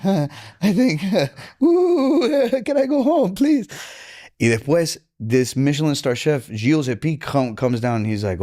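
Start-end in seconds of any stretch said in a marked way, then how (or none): 0.0-3.7 high giddy laughing plea. Retold or imitated. Exhausted after service.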